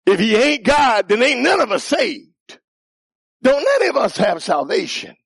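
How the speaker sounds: fiery preaching. Real, not acted. Preacher warning the church none are saved, fiery. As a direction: hammer home the warning. Preacher insisting Jesus must be God.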